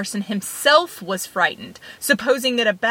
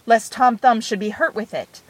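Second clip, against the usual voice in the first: usual speaking voice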